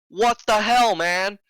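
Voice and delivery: loud, high-pitched outraged yell; retold outburst at a careless driver; angry confrontation. Confronting driver who hit car.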